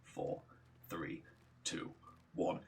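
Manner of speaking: very soft countdown with a pause after each number; barely audible, spaced-out counting; hushed countdown. Retold or imitated. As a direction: count down under your breath. Hushed countdown before kicking door.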